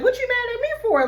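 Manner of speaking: high, incredulous what-are-you-mad-for; puzzled retort. Pretend. Vlogger voicing himself confronting a jealous hater, incredulous. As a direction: pitch it way up in disbelief.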